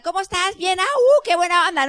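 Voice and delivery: mock girly chatter, high, with a laugh; gushing women. Speaker imitating women greeting each other in the bathroom, gushing high.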